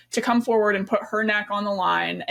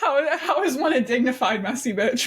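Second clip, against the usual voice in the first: incredulous question through real laughter; laughing; 7 semitones higher than usual. Real, not acted. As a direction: ask it while cracking up, pitched up. Amused by her contradictory phrase.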